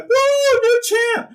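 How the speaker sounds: hammed-up ecstatic whoop, way up high; celebrating yell. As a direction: squeal it high and loud like a new champ. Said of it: "He's like".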